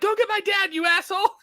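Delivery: high, shouted insult; a high mock shout at the useless cop; mock angry yell. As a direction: yell it at him in frustration. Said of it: "she's like".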